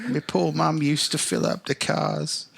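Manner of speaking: high-voiced little urchin, bravely carrying on; plucky orphan. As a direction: play a plucky, pitiful orphan. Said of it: "he's gotta be plucky".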